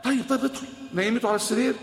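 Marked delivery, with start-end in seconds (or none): 0.0-0.7 softer, gentle comfort; soothing wife. Acted. Khadija calms her frightened husband, soft and gentle. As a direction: hush him in tenderly.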